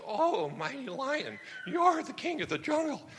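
grovel up to the lion in a small high voice; timid zebra. Acted. Frightened zebra flatters lion.

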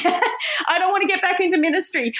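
laughing through the words, voice up high. Real, not acted. Preacher laughing at her past self to the church. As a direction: giggle it out as a confession.

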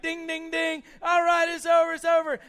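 high, urgent shouting; referee stopping fight. Retold or imitated.

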